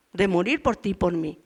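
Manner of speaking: normal talking voice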